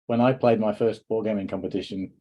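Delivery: usual speaking voice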